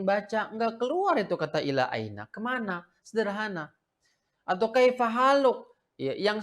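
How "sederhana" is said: ordinary talk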